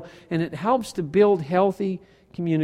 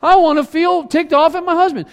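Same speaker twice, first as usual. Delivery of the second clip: mock grumpy spouse; 7 semitones higher, 5 dB louder than usual. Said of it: "they didn't wake up and say".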